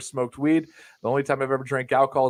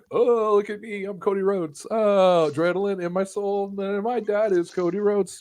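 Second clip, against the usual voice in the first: mocking higher-pitched gushing voice; Cody Rhodes; 8 semitones higher than usual. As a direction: gush it, hammed-up and mocking. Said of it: "and he's like".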